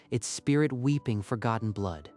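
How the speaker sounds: usual speaking voice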